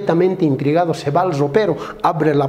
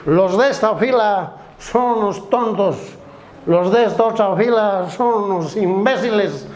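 put-on drunk hurling insults; 4 semitones higher than usual. Drunk insults both rows of bus passengers, dragged slurred voice. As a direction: stretch each insult out like a drunk. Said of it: "borracho empieza a insultar a medio mundo".

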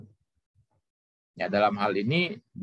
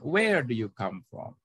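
stressed WHERE, lowered ending; falling question tune. Shown as a demonstration. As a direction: let the pitch sink through to "from".

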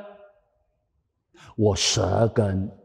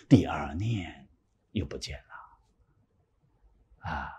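whisper; 5 semitones lower, 8 dB quieter, much slower than usual. Acted. Preacher whispers the thought vanishing.

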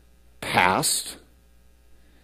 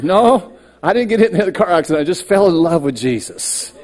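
louder, higher, laughing, very fast denial; laughing reply; 6 semitones higher, 4 dB louder than usual. Retold or imitated. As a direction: laugh it off and blurt it out.

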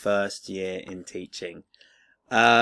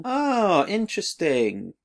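surprised; 9 semitones higher, slower than usual. Real, not acted. Discovering book contents.

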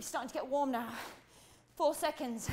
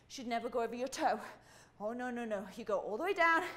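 usual speaking voice

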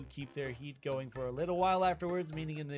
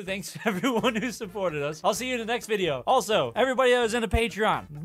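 ordinary delivery

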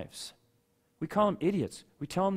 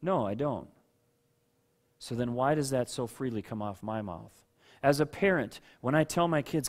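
ordinary talk

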